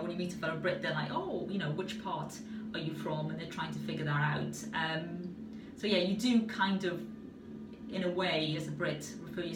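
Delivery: ordinary talk, nothing marked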